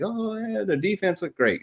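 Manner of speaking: high, sarcastic fake praise; sarcastic high squeal of praise; mocking fans. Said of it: "everybody's like".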